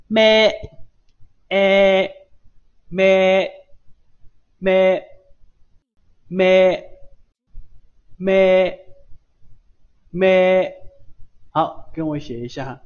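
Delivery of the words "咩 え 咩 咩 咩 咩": 咩 held long, loud and level; pronunciation demo. Shown as a demonstration. Teacher models the kana 咩 for students, loud drawn-out demonstration. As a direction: chant a loud, sustained 咩, raised and even, pausing between repeats. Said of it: "第四个读作咩".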